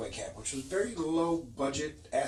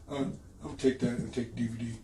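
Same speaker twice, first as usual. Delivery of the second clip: slightly odd dopey mumble; lightly voiced dim fan, a bit off; imitating Frank; 3 semitones lower, 6 dB quieter than usual. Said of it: "This is exactly how he sounds".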